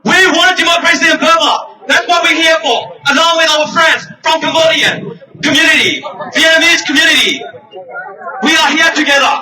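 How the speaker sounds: high, forceful call to a crowd; rallying shout. Real, not acted. Activist rallies friends for democracy in Burma, impassioned shout. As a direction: shout it out like a protest leader.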